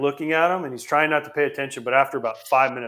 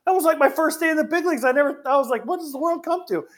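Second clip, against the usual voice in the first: high-pitched mock disbelief; squeaky-high replayed amazement; retold astonishment; 14 semitones higher than usual. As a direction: exclaim like a stunned rookie. Retells own astonished reaction.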